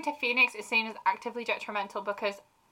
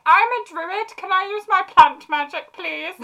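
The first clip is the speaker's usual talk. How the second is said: mock pleading player; 10 semitones higher, 7 dB louder, slower than usual. Druid player stuck waiting for a turn, pleading. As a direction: beg for a turn in a raised little voice.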